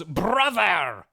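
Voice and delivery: hammed-up British "brother"; British accent. Possessed Ocelot suddenly addressing Snake, mock British accent. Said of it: "he's speaking in a British accent".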